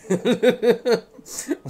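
loud high amused laugh; real delighted laugh. Real, not acted. Laughs at the troll befriending.

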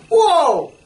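high, loud amazed whoa. Retold or imitated. Preacher voicing a listener's amazement, loud awed whoa. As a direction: gasp in wonder at the connection. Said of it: "And you go".

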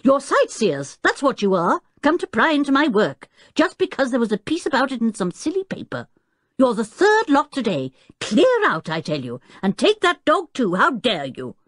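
high, indignant tirade; irate professor ordering people off; angry roar. A narrator's reading. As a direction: rail at the intruders. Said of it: "roared the Professor".

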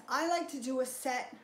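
normal talking voice

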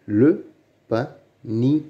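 "Le panier" broken up by pauses; syllable by syllable. Shown as a demonstration. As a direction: sound out "Le panier" one piece at a time. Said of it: "Lis les mots". (« Le panier » détaché syllabe par syllabe)

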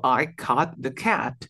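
"I cut the cat" slowly, cut open-jawed, cat with a spread smile; slow it down so cut and cat clearly differ; careful contrast. Shown as a demonstration. Teacher showing a student cut versus cat slowly. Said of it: "Suenan totalmente distintos".

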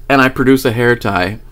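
ordinary delivery

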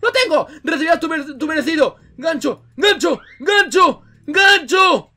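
high, loud attack shouts, building; mock battle cry. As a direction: call out the attack like a hammed-up hero. Grita el ataque del gancho. (grito de ataque teatral, cada vez más fuerte)

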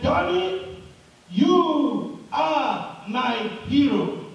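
slow, acted proud shout, high on the name. Father shouts to his son on the mountain, drawn-out call. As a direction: call it out slowly, stretching each word. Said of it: "the daddy shouted on his, on the top of his voice".

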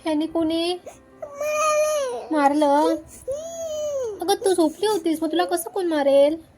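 mother reassuring her daughter after a bad dream; coaxing motherese. Real, not acted. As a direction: cheer her up with a lifted, sing-song voice. Mother soothes crying toddler.

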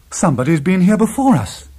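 high, loud cry of discovery; excited cry. A narrator's reading. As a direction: cry it out, astonished at the find. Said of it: "I cried".

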